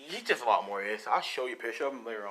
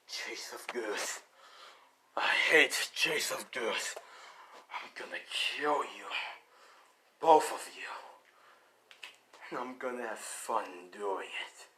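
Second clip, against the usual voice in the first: menacing Rhino voice; 2 semitones lower than usual. Acted. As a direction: drag out the threats like a villain who will enjoy this.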